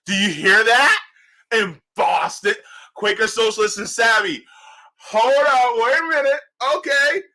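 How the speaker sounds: high, loud hyped calls; excited shout. Real, not acted. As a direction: hype up the viewers. Thrilled by Boston brewery.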